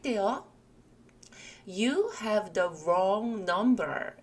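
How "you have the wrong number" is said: very slow, carefully spaced English; slow model phrase. Shown as a demonstration. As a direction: spell each word out slowly for learners. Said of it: "이렇게 사용을 합니다". (또박또박 아주 천천히)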